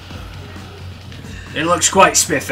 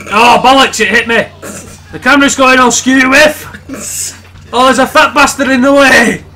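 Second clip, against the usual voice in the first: high, very loud Scottish yell; loud, high-pitched Scottish outburst; Scottish shouting; 11 semitones higher, 10 dB louder than usual. Acted. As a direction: holler in comic alarm. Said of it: "Stop shouting, [name]!".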